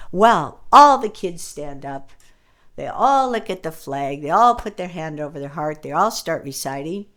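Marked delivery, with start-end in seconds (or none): none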